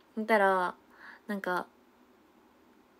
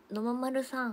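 usual speaking voice

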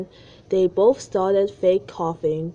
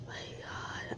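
whispering; 19 dB quieter than usual. Real, not acted. Whispered aside.

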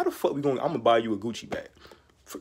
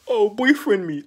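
mock whiny girl; 7 semitones higher, 8 dB louder, slower than usual. Mocking an Instagram girl.

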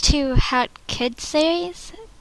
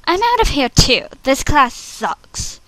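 fed up. Acted. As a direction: announce the walkout openly. Audition take, walking out annoyed.

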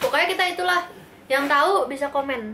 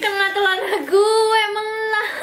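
laughing; 7 semitones higher, slower than usual. Real, not acted. Candy falls on pants.

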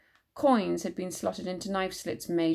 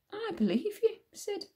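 gentle belief; 13 semitones higher, 4 dB quieter than usual. Acted. Moll quietly siding with Siddy.

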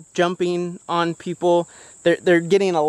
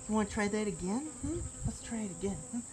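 soft coaxing; 3 semitones higher, 16 dB quieter, faster than usual. Real, not acted. Coaxing puppy quietly.

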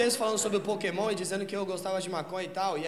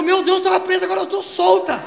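higher, louder relieved exclamation; mock relieved cry; 9 semitones higher, 12 dB louder than usual. Girlfriend freed from between the cars, loud high relief. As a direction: burst out in overjoyed relief. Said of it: "Ela falou".

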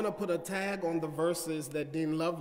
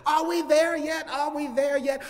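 put-on higher, louder whiny child; child nagging aunt and uncle, higher and louder; impatient kid; 8 semitones higher, 5 dB louder than usual. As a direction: pester like a bored boy in the back seat. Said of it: "I said".

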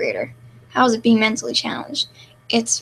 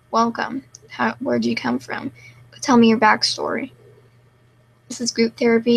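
ordinary talk

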